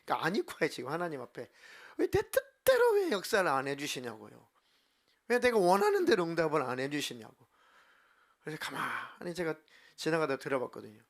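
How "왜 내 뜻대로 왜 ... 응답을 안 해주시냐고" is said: imitating a strained, aggrieved prayer; hammed-up complaint in prayer; frank protesting prayer. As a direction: demand answers from God, straining upward. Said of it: "얼마나 기도를 솔직하게 하시던지".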